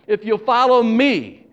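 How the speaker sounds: slow down and land hard on "me"; stress on ME. Acted. Jesus offering the people a choice, stressing "me". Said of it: "the emphasis is on the word me".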